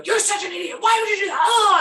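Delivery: high, loud imitated shouting at a driver; mock road rage. A husband yelling at another driver, mock road rage. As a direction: rant at the car ahead, hammed up. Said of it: "to be driving and then go".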